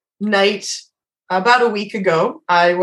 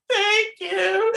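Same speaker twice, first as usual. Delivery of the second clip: high, softer whimper; mock tearful thanks; 13 semitones higher, 5 dB quieter than usual. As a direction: squeak it out as if still crying, hammed up. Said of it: "uncontrollably bawling my eyes out".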